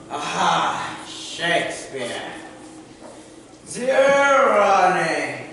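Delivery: ordinary delivery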